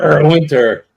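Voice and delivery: gruff pirate growl. Real, not acted. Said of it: "I sounded like a pirate".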